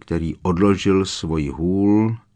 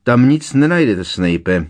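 higher, louder snapped retort; barked; 5 semitones higher, 5 dB louder than usual. A narrator's reading. Moody cuts Snape off over a search, barked. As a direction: bark it at a rival. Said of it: "vyštěkl Moody".

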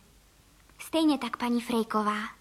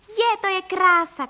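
high, loud, drawn-out exclamation; joyful child's high call; delighted shouting; 7 semitones higher, 5 dB louder than usual. Acted. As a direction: cry out in slow, stretched wonder. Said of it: "křičel Vilda".

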